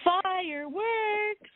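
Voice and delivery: high, slow, drawn-out cheer; hammed-up spirit cheering fireworks; mock cheering. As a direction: cheer it out like a pom-pom fan. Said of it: "Eric said".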